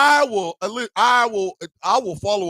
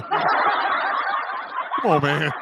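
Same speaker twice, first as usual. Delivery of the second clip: laughing; 3 semitones lower, much slower than usual. Real, not acted. Laughing in disbelief.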